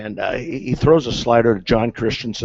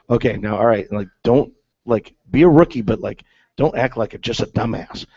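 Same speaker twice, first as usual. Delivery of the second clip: normal talking voice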